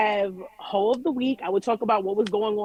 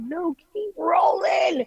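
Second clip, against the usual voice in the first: high, drawn-out excited call; urgent retold order; 8 semitones higher, much slower than usual. Host retelling her order to the cameraman, urgent. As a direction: stretch it out high, like urging the crew on.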